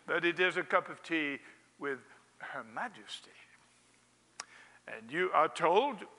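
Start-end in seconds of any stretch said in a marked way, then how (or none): 1.8-3.3 awed whisper. Acted. Preacher telling listeners of tea with the Queen, awed whisper. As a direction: breathe it out in a hushed whisper. Hushed aside about the Queen.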